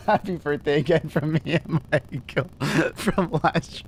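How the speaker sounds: real laughter breaking into the words; laughing hard. Real, not acted. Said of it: "I'm laughing too much".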